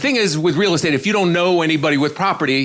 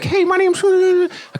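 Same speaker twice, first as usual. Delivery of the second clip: very high, squeaky little mock voice; tiny voice; much slower than usual. Lady introducing herself to the realtor, tiny voice. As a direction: shrink it to a tiny, high-pitched peep. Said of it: "She had a really tiny voice".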